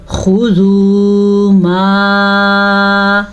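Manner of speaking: slow melodic tajweed recitation; Quran recitation. Shown as a demonstration. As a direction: draw the vowels out like a Quran reciter. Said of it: "teacher recites the verse to teach".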